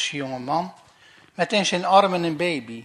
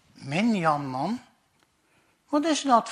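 puzzled; 3 semitones higher than usual. Acted. Officer puzzled question.

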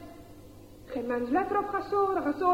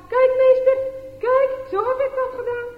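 much higher, louder eager call; proud showing; 9 semitones higher, 8 dB louder than usual. Acted. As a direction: show the teacher, beaming. Gasore shows the teacher.

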